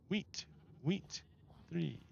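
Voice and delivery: soft muttering to himself; quiet mutter. Real, not acted. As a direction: mumble the word under your breath. Searching inventory, repeating item.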